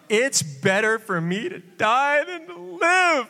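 high, slow, wailing; milk the despair like a sulky child; melodramatic Jonah. Acted. Jonah begging God to let him die, slow high wail.

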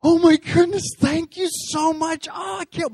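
high, loud gushing thanks; excited daughter. Pretend. Daughter hearing about the Disney trip, thrilled and gushing. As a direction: squeal it like a thrilled little girl. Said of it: "my daughter responds by going".

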